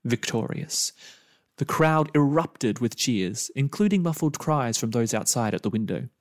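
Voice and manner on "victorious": ordinary delivery, nothing marked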